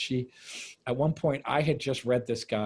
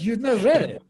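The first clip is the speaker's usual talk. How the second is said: retold indignant rebuke, higher and louder; angry Russian actress; 8 semitones higher, 6 dB louder than usual. Russian actress disputing his claim to have read Platonov, angry. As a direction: imitate her scolding disbelief. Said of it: "She got angry at me".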